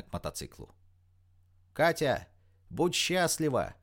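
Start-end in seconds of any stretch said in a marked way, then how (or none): 1.7-3.7 higher, drawn-out call across a road; shouted farewell. A narrator's reading. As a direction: call a parting wish from afar. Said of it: "крикнула она уже из седла с той стороны дороги".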